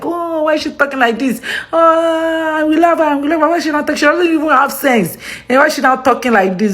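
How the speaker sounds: high-pitched mock complaint; complaining critics. Vlogger mimicking viewers who react to her, high mock complaint. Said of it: "makes you react like".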